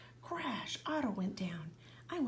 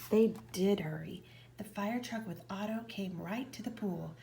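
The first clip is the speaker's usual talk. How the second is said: plain reading voice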